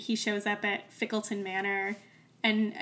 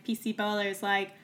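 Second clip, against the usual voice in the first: ordinary talk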